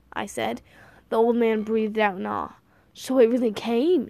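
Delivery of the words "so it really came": usual speaking voice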